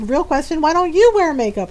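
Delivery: raises pitch and volume on the retort, pointedly; pointed comeback. Retold or imitated. To boys who asked about makeup, a pointed comeback. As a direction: turn the question back on them.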